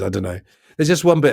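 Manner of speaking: ordinary talk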